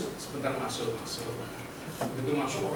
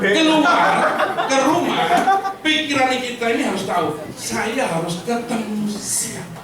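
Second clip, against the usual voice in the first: loud, higher preaching to the room; rousing sales pep talk; 6 semitones higher, 7 dB louder than usual. Acted. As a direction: fire up the agents, full force. Loud motivational preaching to agents.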